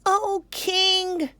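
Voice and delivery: a frantic little monkey pleading. Acted. The monkey pleading with King Lion, high squeak. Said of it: "said the monkey".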